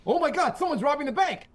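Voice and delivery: high, loud mock panic; hammed-up alarm. Bystander raising the alarm about a bank robbery, mock-panicked. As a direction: cry out like a melodramatic movie bystander.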